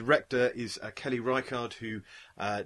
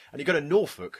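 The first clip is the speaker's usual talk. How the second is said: ordinary delivery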